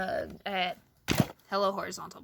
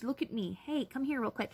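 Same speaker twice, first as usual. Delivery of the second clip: normal talking voice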